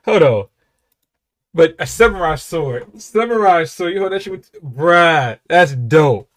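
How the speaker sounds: loud, high, slow excited yelling; hyped shouting. Real, not acted. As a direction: blown away by the lyric. Hyped reacting to the verse.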